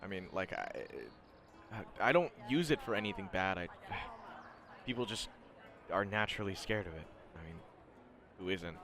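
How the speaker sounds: soft, guarded student; Shinso. Acted. Student defending his feared quirk, quiet and halting. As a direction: explain it quietly, hesitant and defensive. Game master voices Shinso.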